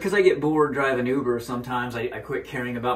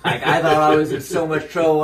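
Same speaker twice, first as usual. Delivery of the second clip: laughing; 4 semitones higher, 6 dB louder than usual. Real, not acted. Laughing over being pranked as child.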